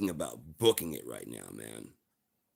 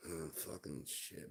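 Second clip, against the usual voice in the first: ordinary delivery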